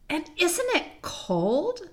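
high, very slow shivery question; chilly complaint. Acted. Susan asks the others if it's cold, complaining. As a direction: draw it out as a complaint about cold.

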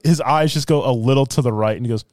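normal talking voice

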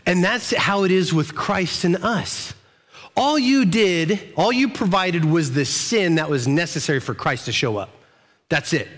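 ordinary talk, nothing marked

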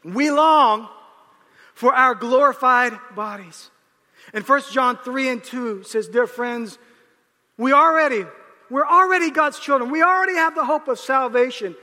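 loud, high comic preaching rant; preaching at full force. Acted. Pastor riffing to the congregation, loud and high. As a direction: belt it out like a pastor venting about aches.

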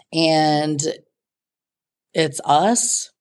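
normal talking voice

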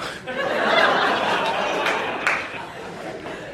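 a long, breathless, high laugh at himself; long wheezy laugh. Real, not acted. Husband laughing at himself for ignoring his wife's storm fears, long wheezy laugh. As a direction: crack up, almost silent, at his own callousness.

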